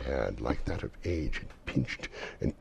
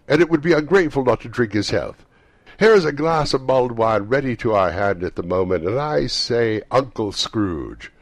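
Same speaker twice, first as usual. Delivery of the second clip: put-on high, loud, fast cheerful young man; cheerful nephew; 7 semitones higher, 10 dB louder than usual. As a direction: toast with hearty good cheer. Fred raising a toast.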